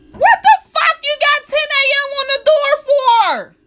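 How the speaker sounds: outraged squeal at the sign; exasperated high shout. Real, not acted. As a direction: shriek it in total disbelief. Exasperated at late business.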